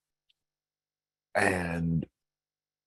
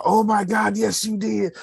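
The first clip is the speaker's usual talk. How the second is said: excited; 14 semitones higher, 5 dB louder than usual. Retold or imitated. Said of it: "I'ma take that maybe as a".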